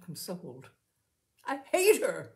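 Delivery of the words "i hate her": higher, louder, desperate outburst; desperate cry. A narrator's reading. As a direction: spit it out in despair. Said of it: "she cried desperately".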